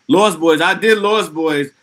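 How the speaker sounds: eager, like a fan who's done it; excited. Real, not acted. Streamer answering chat's Lost Boys request, loud and thrilled.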